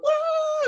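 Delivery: put-on very high, loud drunken woo; spring break whoop; party whoop. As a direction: let out a wild party whoop. Aliens screaming in joy.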